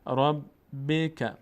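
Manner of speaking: "ربک" with the b doubled; chanted model of "ربک"; syllable drill. Shown as a demonstration. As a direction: intone "ربک" high and slow. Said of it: "یک بار با کسرهٔ خودش".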